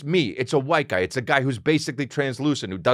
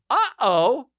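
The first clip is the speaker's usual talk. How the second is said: high-pitched sing-song uh-oh; mock alarm; 11 semitones higher than usual. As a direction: sound a small comic alarm, high. Comic alarm at being alone.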